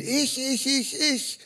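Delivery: mocking a self-centred pray-er, whiny. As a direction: whine self-absorbed.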